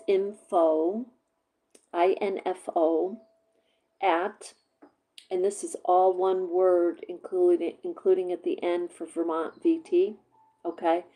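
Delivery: spelling out an email slowly; slow dictation. Shown as a demonstration. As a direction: dictate each part slowly. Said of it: "I'm gonna give you the email address".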